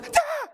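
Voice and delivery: high startled crowd gasp. Retold or imitated. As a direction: gasp like a shocked audience. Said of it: "The whole audience goes".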